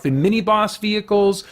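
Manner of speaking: ordinary talk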